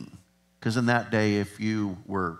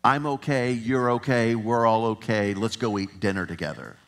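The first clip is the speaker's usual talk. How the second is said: ordinary talk, nothing marked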